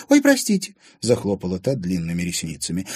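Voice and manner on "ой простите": very high, loud little apology; innocent apology. A narrator's reading. Anfisa apologising after being scolded, mock innocence. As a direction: squeak out an innocent sorry.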